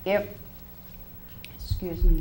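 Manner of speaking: losing voice, hoarse and strained. Real, not acted. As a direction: speak through a worn-out, hoarse throat. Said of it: "I'm losing my voice".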